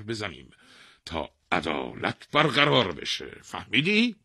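imperious scolding king. A narrator's reading. King rebuking the old man, imperious. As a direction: lecture him loudly, stretching key words.

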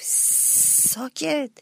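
frightened hiss to silence; fearful hush. A narrator's reading. Hero fearfully hushing the little mouse. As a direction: shush him in fear. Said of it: "با ترس گفت".

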